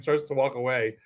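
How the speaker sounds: ordinary delivery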